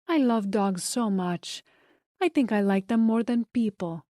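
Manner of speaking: put-on light Spanish accent, airy. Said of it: "with a thick Spanish accent".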